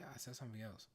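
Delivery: ordinary delivery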